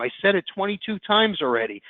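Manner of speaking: ordinary talk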